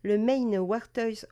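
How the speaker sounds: slow, careful English accent on "Maine Warters"; "Maine Warters" avec un accent anglais appuyé. Shown as a demonstration. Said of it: "attention à mon accent".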